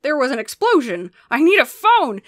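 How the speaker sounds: loud, rising frantic plea; agitated father begging for help; frantic dad. A narrator's reading. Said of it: "Dad cried frantically".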